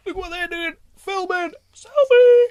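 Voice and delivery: very high, silly singsong squeal ending loud; mock selfie-taker. Co-host imitating himself taking selfies on set, high silly voice. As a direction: squeak it out like an excited selfie fan. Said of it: "I can be like".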